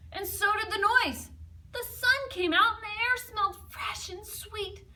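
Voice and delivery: storyteller cheering as the sun returns; brightening. A narrator's reading. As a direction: let the sun burst out in the voice. Sun comes out joyfully.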